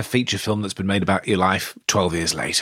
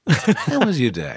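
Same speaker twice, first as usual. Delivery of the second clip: high sing-song; 8 semitones higher, slower than usual. Retold or imitated. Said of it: "ask them".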